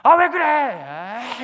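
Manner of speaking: put-on drawn-out, sulky child talking back; a lightly voiced rude teenager; rude whiny kid. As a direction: whine it slowly like a bratty kid. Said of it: "이 녀석이 버릇없게".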